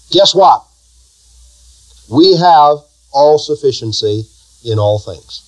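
usual speaking voice

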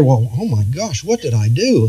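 soft, worried mock voice of a tender listener; fretful churchgoer. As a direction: play the anxious soft-hearted member, hushed. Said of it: "you tender and soft-hearted people, you'll sit there".

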